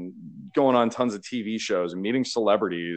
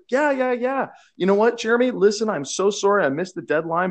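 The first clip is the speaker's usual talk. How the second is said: imitating her much higher, eager, apologetic voice; Sophia's voice; 10 semitones higher, 4 dB louder than usual. Jeremy imitating Sophia apologizing for the late cases, high and eager. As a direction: gush the apology high and bright.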